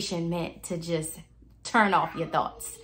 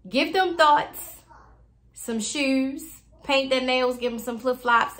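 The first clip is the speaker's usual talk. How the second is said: higher, punchy list of instructions; playful sassy coaching; 5 semitones higher, 4 dB louder than usual. Retold or imitated. Mother teaching her seven-year-old to meditate, playfully sassy. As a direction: stretch the opening, then rattle off playfully. Replaying playful advice on thoughts.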